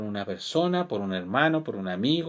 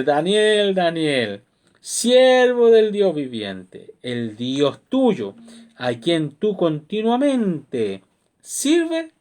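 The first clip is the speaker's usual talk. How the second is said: loud, slow sorrowful call; louder, drawn-out call of a grieving king; calling out sadly; 2 semitones higher, 5 dB louder, much slower than usual. Acted. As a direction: cry the name out into the lions' pit. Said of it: "llamó a voces a Daniel con voz triste".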